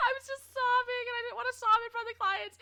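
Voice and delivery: high, loud, mock-tearful squeal; laughing wail. Real, not acted. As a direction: tell it squealing, almost crying with laughter. Laughing while retelling the sobbing.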